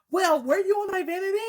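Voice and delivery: put-on high, suspicious kid voice; stepdaughter accusing. Stepdaughter accusing Nisha of using her vanity, high suspicious kid voice. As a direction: let the child accuse her stepmother.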